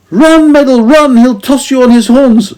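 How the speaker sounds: very high, loud urgent shout; shouted warning. A narrator's reading. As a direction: yell the warning across the yard. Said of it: "shouted Dame Henry".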